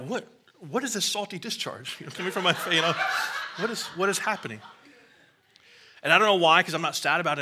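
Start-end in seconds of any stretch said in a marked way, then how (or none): 0.1-4.6 higher, half-laughing bewilderment; puzzled and amused, voice lifted; baffled at his own tears. Retold or imitated. Pastor baffled by his own tears, half-laughing. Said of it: "I'm like".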